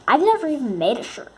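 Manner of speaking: normal talking voice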